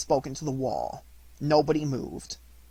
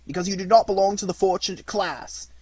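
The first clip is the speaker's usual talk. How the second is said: raised, louder jailer voice; jailer explaining; 5 semitones higher, 6 dB louder than usual. Acted. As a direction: lay down the rule to the puppet.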